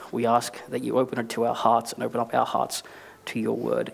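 soft, hushed prayer to God; quiet prayer. Real, not acted. Opening prayer.

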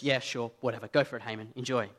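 dismissive king. Retold or imitated. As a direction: wave it through without caring. King lazily approves the plan.

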